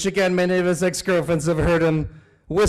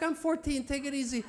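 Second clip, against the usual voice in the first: put-on teen's reply; 10 semitones higher, 6 dB quieter than usual. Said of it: "I'm like".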